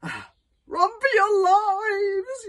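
high, slow, squeaky yelp; a tiny terrified dragon squealing; yelping little dragon. Acted. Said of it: "yelped Toothless".

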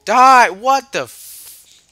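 very loud high scream; loud high furious yell then slow disbelief. Real, not acted. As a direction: scream at the zombie. Killed in game, outburst.

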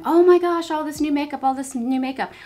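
high, loud mock gush over new makeup; gushing excitement. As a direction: imitate her own breathless gushing. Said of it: "I'm just going".

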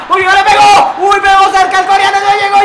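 high, loud near-miss cry; excited shout. Real, not acted.

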